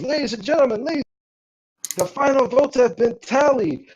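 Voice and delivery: high, ringing MC voice; event announcer. Acted. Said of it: "you hear the ... speak up".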